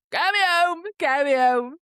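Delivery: hammed-up exhausted begging; desperate plea. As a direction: plead to be taken home. Retold stressed plea to go home.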